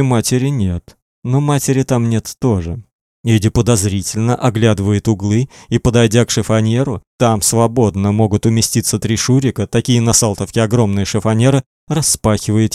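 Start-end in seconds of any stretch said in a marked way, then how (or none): none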